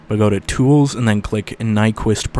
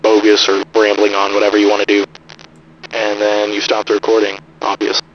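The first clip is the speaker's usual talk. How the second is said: lo-fi radio; 3 dB louder than usual. Shown as a demonstration. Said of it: "not entirely like a radio".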